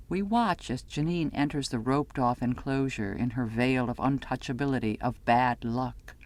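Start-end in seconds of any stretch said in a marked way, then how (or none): none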